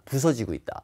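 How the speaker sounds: ordinary talk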